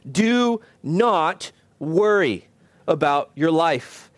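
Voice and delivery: slow, weighty DO, NOT, WORRY, each word set apart; slow solemn prohibition, word by word; emphatic command. Real, not acted. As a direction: space out "Do not worry" with deliberate pauses. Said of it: "Why do I emphasize this".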